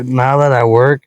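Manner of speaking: ordinary talk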